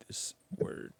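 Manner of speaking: hushed secret whisper. Acted. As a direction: whisper it to the viewer. Whispering to viewer.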